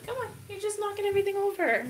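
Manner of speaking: telling off the kitty in a high voice; high pet-scolding voice. Real, not acted. Scolding cat knocking things over.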